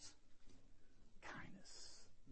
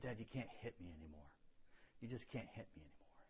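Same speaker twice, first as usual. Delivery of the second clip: low, soft, controlled firmness; quietly standing up; 5 semitones lower, 12 dB quieter, faster than usual. Retold or imitated. Son to his father after grabbing his fist, quietly firm. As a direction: say it low and hushed, holding your ground.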